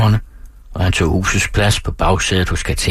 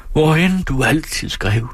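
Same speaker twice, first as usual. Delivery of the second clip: high mock lament; screechy singer; 8 semitones higher than usual. A narrator's reading. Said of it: "anråbte skrigepotten".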